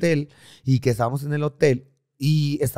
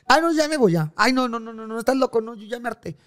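hammed-up diva tantrum; fake huff; 10 semitones higher than usual. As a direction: act insulted to cover the theft. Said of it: "Me enojé".

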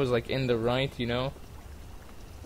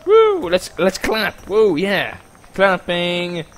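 cheering; 5 semitones higher, 7 dB louder, slower than usual. Real, not acted. Cheering at the wedding.

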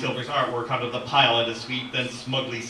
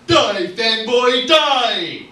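high, loud, stretched-out shout; hammed-up action-movie death threat; battle yell; 11 semitones higher, 11 dB louder, much slower than usual. Pistol showdown with a fanboy, full shout. Monk attacks as pistol fires.

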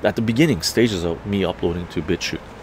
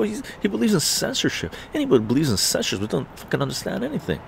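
higher, fast, worked-up; ranting at his friend about censorship; heated rant; 8 semitones higher, faster than usual. Retold or imitated. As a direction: keep hammering the point. Retold heated argument.

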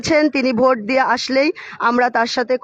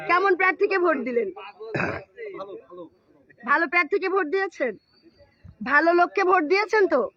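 to someone hard of hearing; 4 semitones higher, 3 dB quieter than usual. Real, not acted. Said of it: "উনি হয়তো কানে শোনে না".

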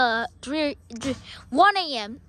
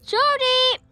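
high, drawn call; 7 semitones higher, slower than usual. Pretend. Searcher calling for Shorty, high raised voice.

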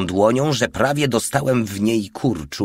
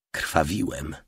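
usual speaking voice

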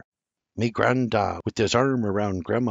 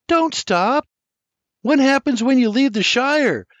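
put-on much higher, louder little girl whine; whining child; 13 semitones higher, 5 dB louder than usual. A narrator's reading. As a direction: beg him to keep going. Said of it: "Lizzie whines".

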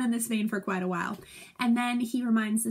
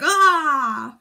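big mock war yell, much louder; battle shout; 5 semitones higher, 12 dB louder than usual. Teacher imitating Henry's charge, loud yell. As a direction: roar the charge. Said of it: "And he's like".

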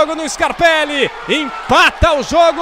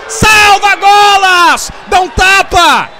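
excited shout; 3 semitones higher, 5 dB louder than usual. Real, not acted. Save on the line, shouting.

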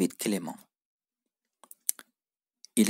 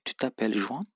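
Tu t'appelles Juan? asked only by the rising pitch on Juan; rising question tune. Shown as a demonstration. Teacher showing learners a question by intonation. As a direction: let Juan climb at the end to ask. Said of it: "Vurguyla soruyoruz bunu".

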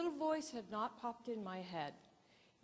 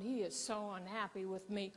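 normal talking voice

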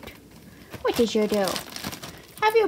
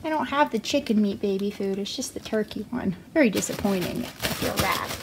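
ordinary talk, nothing marked

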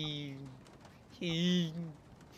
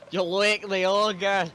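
slow and loud, ogre stretched; loud slow warning; 4 semitones higher, 11 dB louder, much slower than usual. Acted. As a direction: call the warning out, slowly. Voicing warning character, redo.

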